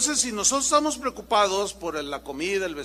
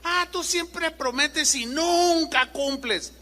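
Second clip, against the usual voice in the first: nagging wife; 8 semitones higher, slower than usual. Retold or imitated. Wife scolds husband.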